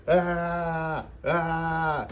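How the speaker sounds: drawn-out mock "aah", high pitched; high, held wail in mild parody; mock scream. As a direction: imitate a driver wailing "aah" without full volume. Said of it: "Semi driver screaming".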